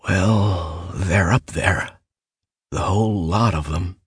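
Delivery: slow, rasping, worn-out voice; barely a rasp. A narrator's reading. Mallory answering Bill about the woods, a slow rasp. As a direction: scrape the words out slowly. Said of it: "His voice was barely a rasp".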